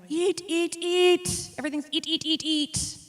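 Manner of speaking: imitating a clucking mother hen. Asha hovering behind her at the banquet, pushy mother hen voice. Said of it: "like a mother hen going".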